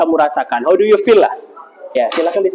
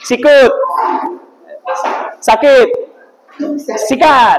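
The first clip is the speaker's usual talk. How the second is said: game commands; 7 semitones higher, much slower than usual. Real, not acted. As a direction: bark each command to the class. Teacher calling game commands.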